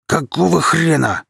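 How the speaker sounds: loud, high angry roar at being stuck. A narrator's reading. Kirill roaring at the sand that holds him. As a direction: bellow it out in disbelief. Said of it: "взревел парень".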